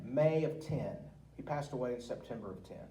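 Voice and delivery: ordinary delivery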